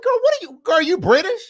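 high, mocking "girl, you British?"; mock disbelief. Host mocks Trina's accent in a high, teasing voice. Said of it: "like, you're like".

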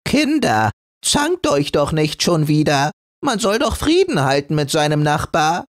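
high, gentle calming call; an old granny calming quarrelling kids; old tassel soothing the scraps. Acted. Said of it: "begütigend".

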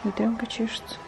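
quick unvoiced whisper. Real, not acted. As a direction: whisper it aside. Whispered aside to camera. (шёпотом)

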